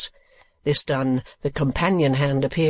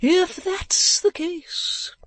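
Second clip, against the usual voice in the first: very high, drawn-out eccentric voice; old gentleman; much slower than usual. Acted. Old gentleman on wall weighing the news, eccentric. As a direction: stretch it out high, pondering.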